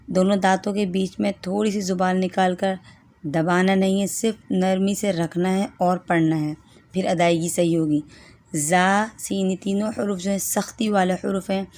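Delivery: ordinary delivery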